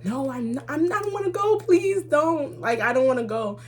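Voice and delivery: high-pitched mock pleading; begging. Daughter begging mom not to send her to Ghana, pleading. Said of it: "I'm begging and pleading her".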